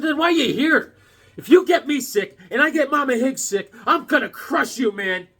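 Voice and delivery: high-pitched indignant rant; retold threat with mock outrage; angry telling-off. Telling off a sick gas station kid, high indignant scolding.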